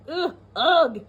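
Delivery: mock disgusted grunts; playing up disgust for the kids; disgusted ugh. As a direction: go ugh at the slimy slugs. Disgust at slugs in story.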